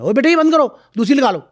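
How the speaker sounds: high, loud mock parent telling the kid off; scolding parent. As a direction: imitate a parent ordering the child to stop. Parents telling child to switch game.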